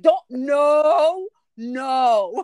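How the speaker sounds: high, loud mock-crying "no"; imitated tearful protest. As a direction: plead as if tears are starting, hammed up. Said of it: "then I start crying".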